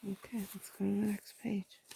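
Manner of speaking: hushed whisper to a listener; whispered aside. Real, not acted. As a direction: whisper while showing the picture. Whispered asides over the pictures.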